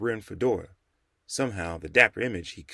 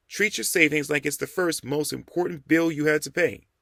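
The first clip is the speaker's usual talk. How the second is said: put-on higher young man's voice reciting a rule; 7 semitones higher, 4 dB louder than usual. A narrator's reading. Jamal recites the saving rule to the Rev, higher. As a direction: prove he learned the lesson.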